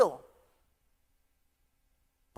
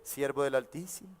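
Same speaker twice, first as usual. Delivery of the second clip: mock reverent teen; 8 semitones lower, 11 dB quieter than usual. Imitating teen flattering father.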